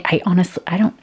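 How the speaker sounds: ordinary talk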